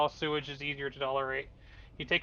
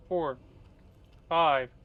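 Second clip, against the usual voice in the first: more alarmed with each number; growing disbelief; 2 semitones higher, 5 dB louder, much slower than usual. Real, not acted. Counting slimes, growing alarm.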